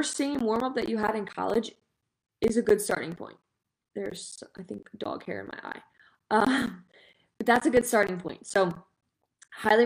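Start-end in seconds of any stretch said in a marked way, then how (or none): 4.0-5.8 muttered aside. Real, not acted. Muttered aside about eye.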